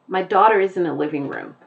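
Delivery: brisk American sentence, r sounded in daughter; American accent. Shown as a demonstration. Teacher compares musicality of two accents, American accent. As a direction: say it in General American. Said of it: "the way that I pronounced the words was different".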